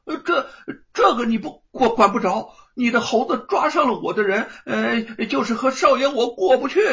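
high, stammering, nervous bluster; a bully caught out, stammering excuses; guilty and flustered. A narrator's reading. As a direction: stumble over words, losing nerve. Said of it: "有些心虚地说".

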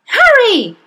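high, loud frightened cry; alarmed cry. Acted. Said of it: "cried the mother".

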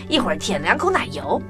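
ordinary delivery, nothing marked

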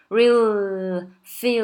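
loud, stretched real and feel; real, feel, drawn out on the final L; held dark L. Shown as a demonstration. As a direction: hold the L of real and feel on the tongue tip. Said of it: "你舌头要放的点".

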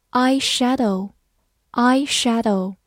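slow, clear vocabulary repeat; slow model word. Shown as a demonstration. Teacher modelling new words slowly for the class. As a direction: say each word slowly twice. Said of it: "teacher models vocabulary slowly".

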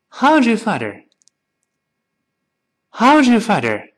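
"How's your" run together, s blurring into a buzzy r-like zh; high start on "How's", "How's your" fused as how-zhur; linked sound change. Shown as a demonstration. Teacher has students read along, "How's your" fused as how-zhur. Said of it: "你发现就变成日了".

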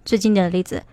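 normal talking voice

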